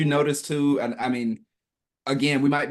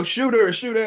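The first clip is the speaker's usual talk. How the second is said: high-pitched mock firing order; panicky soldier; 9 semitones higher, 4 dB louder than usual. Imitating soldiers facing Rogue, panicky soldier. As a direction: call out like a flustered guard, half joking. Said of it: "they're just like".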